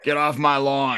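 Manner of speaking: lightly voiced grumpy old man; grumpy old guy. Host joining the guest's joke, mock grumpy old guy. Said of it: "sounding like the grumpy old guy here".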